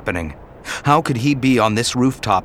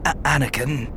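faint call; 4 semitones higher than usual. Acted. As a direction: call his name weakly. Dying Obi-Wan calls.

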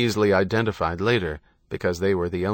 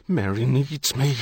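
higher-pitched desperate insistence; distraught rage; 4 semitones higher than usual. A narrator's reading. Husband struggling against policeman, distraught. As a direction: insist you must reach her. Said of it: "drove John into insensate rage".

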